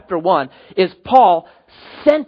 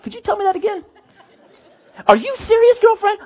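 put-on pitched way up, a shocked young woman; high girly voice. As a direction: squeal it like an appalled girlfriend.

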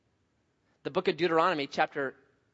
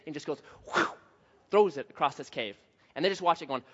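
ordinary talk

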